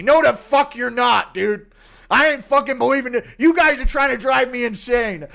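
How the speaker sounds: put-on old man refusing, loud and paranoid. Old Chandler denying his son, loud stubborn old man.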